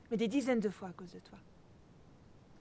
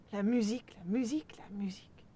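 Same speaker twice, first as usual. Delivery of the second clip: hushed, bitter repetition; whispered reproach; slower than usual. Acted. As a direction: drop to an unvoiced whisper. Second take, voice drops away.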